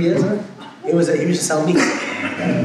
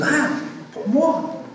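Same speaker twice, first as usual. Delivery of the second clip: greedy customer; 10 semitones higher than usual. Retold or imitated. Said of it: "He goes".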